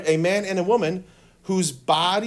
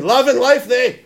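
louder mock Shrek voice; 6 semitones higher, 9 dB louder than usual. Shown as a demonstration. Teacher leads the class chant as Shrek. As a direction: boom it out like Shrek. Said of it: "Pretend you're Shrek".

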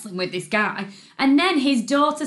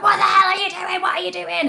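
loud, high re-enacted outrage; protective angry shout; 9 semitones higher, 6 dB louder than usual. Protective dog mum confronting the cyclist, angry shout. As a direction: roar it like a bear guarding her young. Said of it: "I was like a bear".

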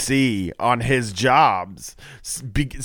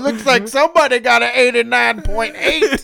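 prison guard; 11 semitones higher, 7 dB louder than usual. Pretend. Mock warden gloating.

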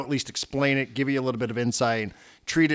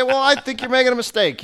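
heated retort; 12 semitones higher, 5 dB louder than usual. Retold or imitated. Retold argument with manager on phone.

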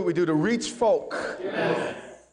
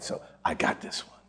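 hushed God voice; 7 dB quieter than usual. Acted. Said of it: "God said".

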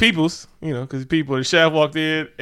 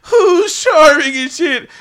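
high, slow, hammed-up gushing; mock swooning praise; 12 semitones higher, 6 dB louder, slower than usual. Friend mocking a charming coke dealer, high hammed-up gush. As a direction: gush over him in a silly high voice.

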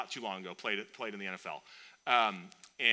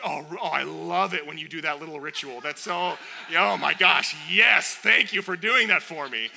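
loud, high, hammed-up rapture; mock gushing delight; 6 semitones higher, 12 dB louder than usual. God thrilled by a little ritual, mock gushing. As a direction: gush over it, building to a big mock thrill.

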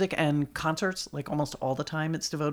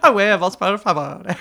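mock Spanish accent; 4 semitones higher, 9 dB louder than usual. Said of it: "That was my Spanish accent".

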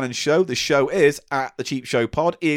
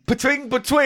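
high sung-out point chime; machine ding imitation; 10 semitones higher than usual. Host awarding his guest a point, high machine chime. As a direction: voice the scoring machine's ping, pitched up.

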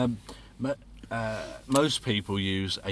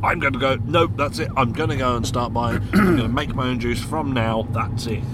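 excited; 4 semitones higher, 8 dB louder than usual. Retold or imitated. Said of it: "waking up one day and going".

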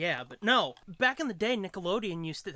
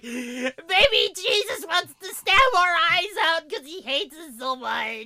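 much higher, louder, slow mock wail; mock whiny kid; 10 semitones higher, 8 dB louder, slower than usual. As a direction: drag it out like a dramatic child. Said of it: "be like".

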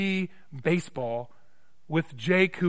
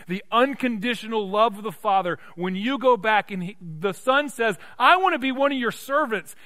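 full-force preaching; 9 semitones higher, 12 dB louder than usual. Acted. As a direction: proclaim it as the overjoyed father, ever stronger. Preaches the father's proclamation.

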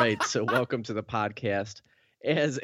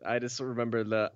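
ordinary talk, nothing marked

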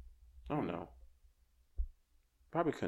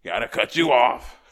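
louder and slower insistent repeat; loud, slow, final cutoff; firm repeat; 11 dB louder than usual. Retold or imitated. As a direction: slam the door on it, louder and slower. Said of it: "I said".

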